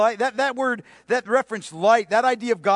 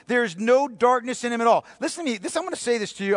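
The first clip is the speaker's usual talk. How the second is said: usual speaking voice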